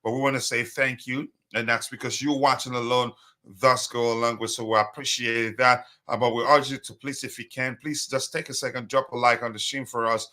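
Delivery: ordinary talk, nothing marked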